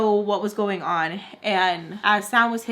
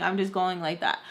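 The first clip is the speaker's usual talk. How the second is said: normal talking voice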